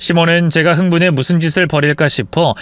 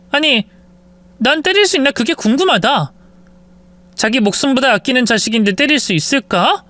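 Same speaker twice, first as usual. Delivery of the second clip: cold wife; 7 semitones higher than usual. Acted. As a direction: explain the punishment with relish. Wife explains her cruel logic.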